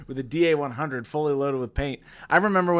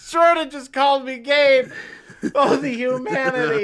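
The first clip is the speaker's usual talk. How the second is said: high, loud, laughing mock despair; mock outrage; 11 semitones higher, 7 dB louder than usual. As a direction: wail it like a tragedy. Mock outrage over a nickname.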